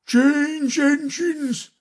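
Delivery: high, slow hoarse call; a horse-like hoarse voice calling out. A narrator's reading. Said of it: "a hoarse voice spoke next".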